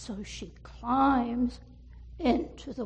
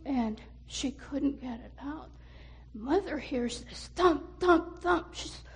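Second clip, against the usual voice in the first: ordinary delivery, nothing marked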